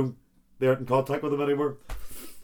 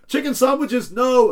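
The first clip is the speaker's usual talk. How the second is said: high, loud comic outcry; mock-anguished shout; despairing cry; 11 semitones higher, 6 dB louder, slower than usual. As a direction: wail it like a punchline character at the end of his tether. Joke character despairs at lunch.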